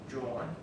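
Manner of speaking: "John" said as a soft rolled jawn; Magazine Street John. Shown as a demonstration. A New Orleans native showing the locals' way of saying John. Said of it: "proper way to pronounce the name John".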